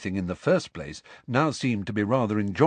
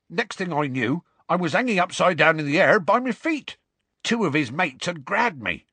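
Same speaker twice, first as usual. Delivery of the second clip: higher, excited rustic storyteller; Hagrid in mid-adventure; 9 semitones higher, 4 dB louder than usual. Acted. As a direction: relive the mishap in a rustic voice. Hagrid tells his tale.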